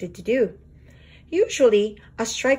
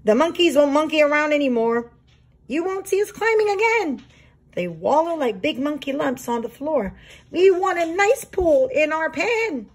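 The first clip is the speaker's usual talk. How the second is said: complaining monkeys; 7 semitones higher than usual. Acted. Monkeys voice their demands.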